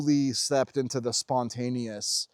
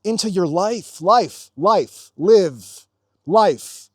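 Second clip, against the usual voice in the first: life, life, life, each one punched louder; emphatic repetition; 4 semitones higher, 6 dB louder, much slower than usual. Shown as a demonstration. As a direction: hammer the word life over and over. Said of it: "repeating life with rising force".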